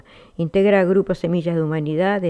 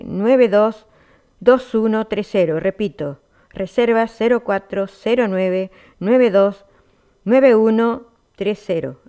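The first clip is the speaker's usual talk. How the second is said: bright, punchy event promo; radio announcer; 3 semitones higher, 4 dB louder than usual. Acted. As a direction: sell the fiesta to listeners.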